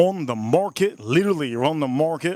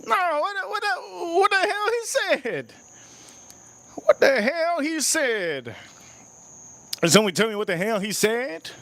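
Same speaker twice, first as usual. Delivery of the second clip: baffled outcry; 12 semitones higher than usual. Real, not acted. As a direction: wail it in comic disbelief, then ease down in pitch. Baffled by clip's thick accent.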